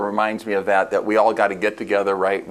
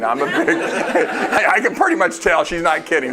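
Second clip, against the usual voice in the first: laughing; 8 semitones higher, slower than usual. Real, not acted. Laughing at the old lady.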